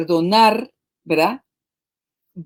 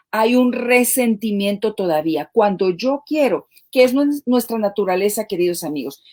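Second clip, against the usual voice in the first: ordinary talk, nothing marked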